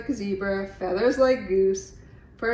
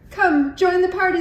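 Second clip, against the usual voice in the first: much higher, louder call; 10 semitones higher, 9 dB louder than usual. A narrator's reading. Party guests inviting Iguana, loud high call. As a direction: call her over warmly. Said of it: "they called".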